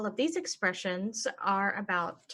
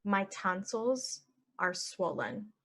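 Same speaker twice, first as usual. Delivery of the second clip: slow and even; slow model; slower than usual. Shown as a demonstration. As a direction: say it slowly for learners. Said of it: "normal then slow".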